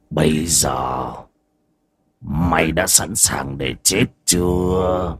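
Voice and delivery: low, soft, slow menace; menacing zombie voice. Acted. Undead creature threatens old man.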